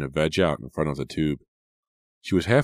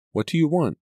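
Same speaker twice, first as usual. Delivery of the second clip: high, louder, frightened demand; scared demand; 12 semitones higher, 4 dB louder than usual. A narrator's reading. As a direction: push back against the fear.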